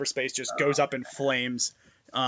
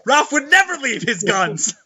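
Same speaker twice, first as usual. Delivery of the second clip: laughing; 10 semitones higher, 7 dB louder than usual. Real, not acted. Laughing through the line.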